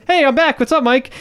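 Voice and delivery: high, breezy imitated greeting; carefree Manny Junior. Voicing Manny Junior's carefree return.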